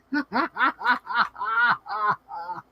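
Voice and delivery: high giggle trailing down; quiet giggling fit; soft laugh. Real, not acted. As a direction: giggle at yourself. Laughs at own coo.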